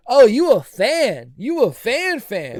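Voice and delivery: high, loud, slow and thrilled; delighted surprise. Retold or imitated. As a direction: savor the moment, amazed. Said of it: "I said".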